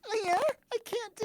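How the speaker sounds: put-on very high squeaky anime girl. Shown as a demonstration. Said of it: "Try it".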